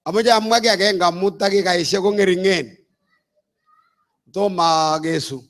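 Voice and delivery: ordinary talk, nothing marked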